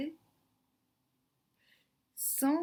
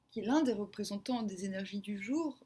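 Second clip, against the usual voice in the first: normal talking voice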